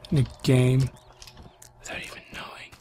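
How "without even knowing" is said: trailing hushed whisper. Real, not acted. Lost player musing to viewers, whispering. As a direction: let it fade into a whisper.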